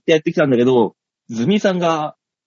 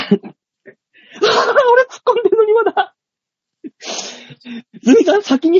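high laughing cry of disbelief; laughing protest, pitched high; laughing outcry. Real, not acted. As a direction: shout it through laughter. Laughing outrage at partner.

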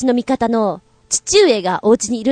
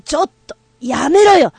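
higher, louder rough stop it; yelling stop; 6 semitones higher, 3 dB louder than usual. Acted. Sister yells at the other to stop shoving. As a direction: shout at her to quit.

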